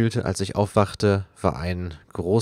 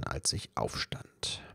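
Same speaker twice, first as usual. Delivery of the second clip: whisper; 13 dB quieter, slower than usual. A narrator's reading. Groggy narration of waking.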